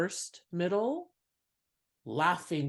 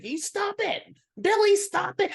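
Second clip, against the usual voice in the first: high, louder squeaky wife telling husband off; scolding wife; 5 dB louder than usual. Retold or imitated. Wife scolding husband Billy at the show, high mock voice. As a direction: play the wife swatting her husband.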